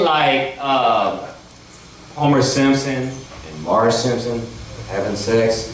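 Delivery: usual speaking voice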